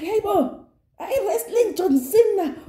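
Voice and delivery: high, startled call to the raging castmate; mock shocked. Narrator calling out to castmate throwing the table, high mock shock. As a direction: ham up the disbelief, voice jumping up.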